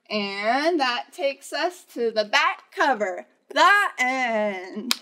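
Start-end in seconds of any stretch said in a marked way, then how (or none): none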